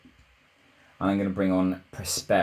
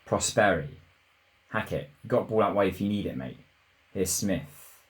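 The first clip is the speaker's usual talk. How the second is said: usual speaking voice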